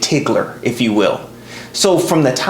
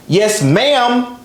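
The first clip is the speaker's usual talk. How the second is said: "ma'am" stretched and leaned on, pitched up; extra emphasis on ma'am; 7 semitones higher than usual. Retold or imitated. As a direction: mimic her punching "ma'am" after yes. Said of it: "she always put extra emphasis on the word ma'am".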